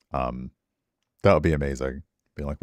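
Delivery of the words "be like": normal talking voice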